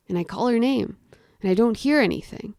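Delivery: ordinary delivery, nothing marked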